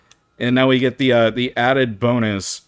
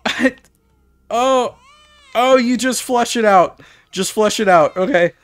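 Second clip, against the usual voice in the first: panicked; 8 semitones higher than usual. Real, not acted. Panicking to be rid of it.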